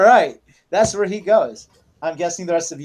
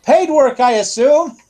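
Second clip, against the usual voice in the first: high, loud question from a deaf clerk; raised, over-loud in-character query; deaf man's loud voice; 7 semitones higher, 4 dB louder than usual. Acted. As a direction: ask it too loudly, as if hard of hearing. Deaf clerk Joe answers the note.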